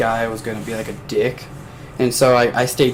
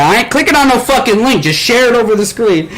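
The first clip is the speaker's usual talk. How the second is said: high, loud mock Southern refusal; loud Southern accent; 11 semitones higher, 4 dB louder than usual. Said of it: "talking that fucking old-fashioned Southern".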